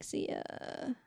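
breathy throwaway see ya; a whispered, careless see ya; whispered goodbye. Retold or imitated. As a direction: toss it off in a whisper. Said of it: "he's just like".

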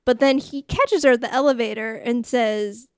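ordinary talk

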